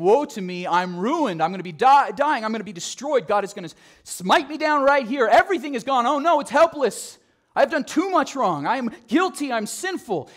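hammed-up terror of a doomed man; panicked wail. Frantic Isaiah acted in sermon.

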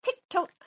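high, crisp tick-tock; ticking clock. A narrator's reading. Living room clock ticking through the night, high and crisp. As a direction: click like a clock. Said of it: "went the living room clock".

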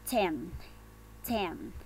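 ten with a puffed t; aspirated T. Shown as a demonstration. Teacher reminding students of the T, aspirated ten. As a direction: blow out the t at the start of ten. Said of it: "No se olviden de la T".

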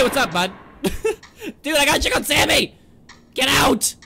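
ordinary talk

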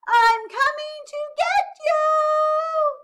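very high, slow full-voiced shout; high loud little-penguin call; crying out. A narrator's reading. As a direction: holler it across the snow, stretching each word. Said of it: "he cried".

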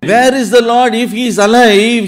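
high-pitched cry of complaint to God; voiced lament, pitched well up; acted despairing plea. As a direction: plead as a believer who feels abandoned. Voicing a despairing believer's complaint.

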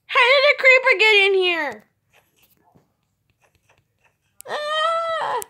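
high shrieking alarm; startled. Real, not acted. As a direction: shriek at the creeper. Creeper appears.

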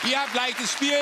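high, loud hype; excited host. Acted. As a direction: pump up the contestants. Host hypes contestants.

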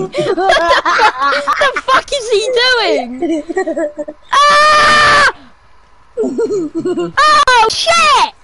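high, loud frantic yells; real excited gamer shouting; game shouting. Real, not acted. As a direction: yell at the screen in the heat of a fight. Frantic shouting during game fight.

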